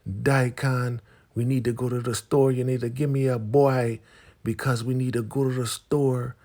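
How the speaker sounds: high, loud put-on woman's voice; Elder Mother Mava. Elder demanding Daikon go to the store, high nagging caricature. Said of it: "Elder Mother Mava, and she's like".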